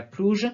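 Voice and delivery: "plouge" with a zh ending; J as in French. Retold or imitated. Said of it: "Une personne que je connais dit".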